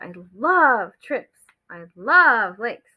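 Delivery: love stretched long in each sentence; stress on love. Shown as a demonstration. Teacher replays the bold words she read, heavy emphasis on love. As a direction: lean on love and draw it out. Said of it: "like that".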